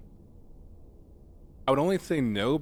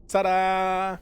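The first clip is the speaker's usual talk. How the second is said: higher, louder, drawn-out showy flourish; triumphant magician cat; 7 semitones higher, 7 dB louder than usual. Acted. Cat showing a trick once people leave, triumphant. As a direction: sing out a big stage reveal. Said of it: "they'd be like".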